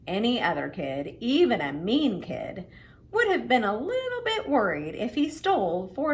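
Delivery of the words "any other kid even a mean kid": ordinary delivery, nothing marked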